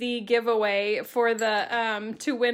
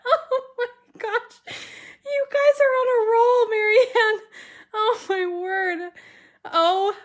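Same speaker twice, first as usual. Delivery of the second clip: laughing to tears, voice pitched way up and loud; high teary laughter; 8 semitones higher, 4 dB louder than usual. Real, not acted. Host laughing to tears as viewers keep joking in chat. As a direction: gasp the words through real laughter.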